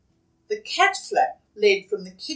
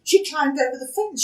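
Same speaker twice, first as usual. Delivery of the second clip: ordinary delivery, nothing marked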